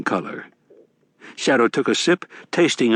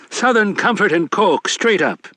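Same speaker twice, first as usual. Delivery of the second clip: higher, brash Irish barfly; rowdy leprechaun; 10 semitones higher than usual. A narrator's reading. Mad Sweeney voiced in narration.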